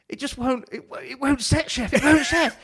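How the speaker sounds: put-on high, loud, frantic young cook; pleading upward in a panic; panicked plea. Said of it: "I'm like".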